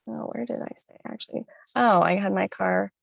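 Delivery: usual speaking voice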